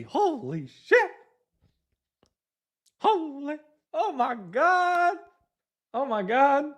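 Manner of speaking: high, slow amazed exclamation; stunned. Real, not acted. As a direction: gasp it out in disbelief. Stunned by a wholesale deal.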